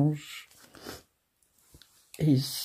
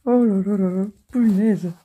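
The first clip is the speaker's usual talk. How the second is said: loud giggly sigh; laughing; 4 semitones higher, 6 dB louder than usual. Real, not acted. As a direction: still laughing, can't stop. Encore en fou rire. (encore en fou rire, plus fort)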